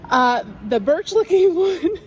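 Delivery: laughing through the word birch again. Real, not acted. Vlogger cracks up at repeating birch, laughing. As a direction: giggles break into the phrase mid-word. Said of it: "Take a shot every time I say birch".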